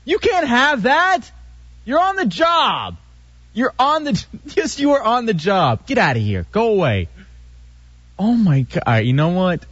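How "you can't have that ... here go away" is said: mock scolding. Scolding drunk co-worker, high voice.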